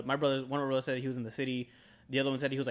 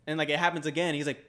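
usual speaking voice

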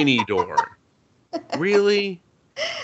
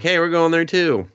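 mock chirpy voice; 8 semitones higher, 3 dB louder than usual. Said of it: "They're like".

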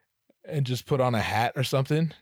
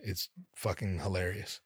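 ordinary delivery

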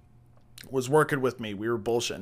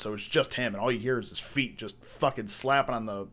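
ordinary talk, nothing marked

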